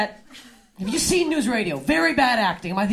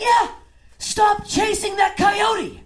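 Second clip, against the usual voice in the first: yelling cop; 7 semitones higher, much slower than usual. Retold or imitated. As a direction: bellow it from a passing squad car. Cop yelling from car window.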